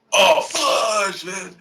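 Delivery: sudden loud alarmed cry; startled outburst. Real, not acted. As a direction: blurt it out in real alarm. Dead air scare on podcast.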